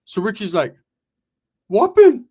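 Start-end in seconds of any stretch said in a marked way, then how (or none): 1.7-2.2 high loud call of the name; Ricky calling out the host. Retold or imitated.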